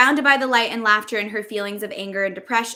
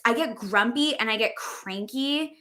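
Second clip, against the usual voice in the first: ordinary delivery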